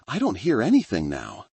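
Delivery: ordinary delivery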